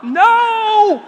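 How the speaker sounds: loud, high, drawn-out shout; hammed-up cry of rejection; defiant refusal. Unteachable teen refusing dad's wisdom, defiant shout. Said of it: "Palm out".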